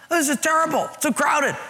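very high-pitched whiny mimicry; mock complaining. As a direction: parody a churchgoer's whine. Mimics churchgoers whining about crowding.

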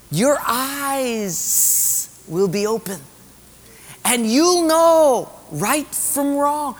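high, drawn-out devil's coaxing; tempting devil. Acted. Devil tempting Eve to eat the fruit, high coaxing voice. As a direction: stretch the key words high, luring her in. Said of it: "what the devil was saying".

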